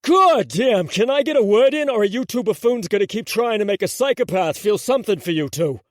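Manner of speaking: high, loud exasperated holler; loud fed-up bellow at the two buffoons. Acted. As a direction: lose your cool and yell over them. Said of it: "hollering at them".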